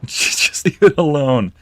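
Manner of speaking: slow, high, loud mocking echo with a laugh; mocking laughing repeat. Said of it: "repeats co-host, laughing".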